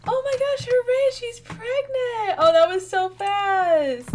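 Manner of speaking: high, loud, thrilled outburst; overjoyed squeal at the news; excited squeal. Real, not acted. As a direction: shriek it with pure delight. Sim is pregnant, thrilled.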